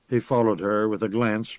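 plain reading voice

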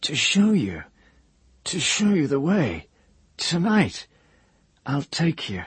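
high, eerie half-mad voice; a broken man stating a dark truth; crazed old wizard. A narrator's reading. Hare's voice.

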